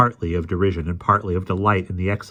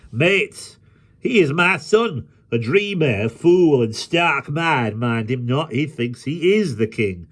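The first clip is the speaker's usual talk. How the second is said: high, raised voice making himself heard; Hobbs calling out over din; 7 semitones higher, 4 dB louder, slower than usual. A narrator's reading. Hobbs tells his mates about his son, calling out high. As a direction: call to the mates over the noise, high and emphatic.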